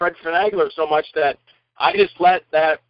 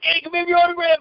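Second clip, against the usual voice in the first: much higher, eager call; excited fans; 12 semitones higher than usual. Retold or imitated. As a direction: fans crowding around. Excited extras voiced.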